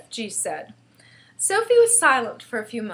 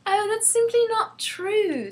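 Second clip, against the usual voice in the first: put-on high, drawn-out girlish indignation; angry little girl protesting; indignant cry; 12 semitones higher, 4 dB louder than usual. A narrator's reading. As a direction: contradict crossly in a high, slow voice. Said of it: "Sophie cried angrily".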